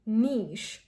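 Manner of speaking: Niche as "neesh"; Niche to rhyme with "leash"; correct pronunciation. Shown as a demonstration. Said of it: "giving you the correct pronunciation".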